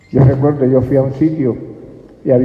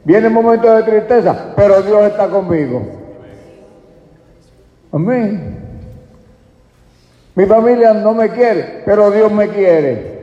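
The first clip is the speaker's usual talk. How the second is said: high, loud preaching cry; loud preaching with a shouted hallelujah edge; full-force preaching; 9 semitones higher, 7 dB louder than usual. Acted. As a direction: preach it out to the congregation.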